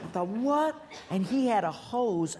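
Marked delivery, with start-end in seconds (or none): 0.3-0.8 shocked what. Retold or imitated. Sees flooded room.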